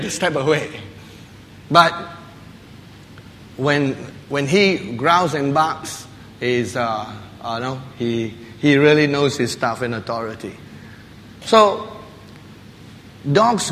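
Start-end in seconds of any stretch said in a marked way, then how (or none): none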